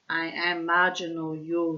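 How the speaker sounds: put-on light Russian accent. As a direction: speak English with a Russian accent. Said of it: "I'm putting in the Russian accent".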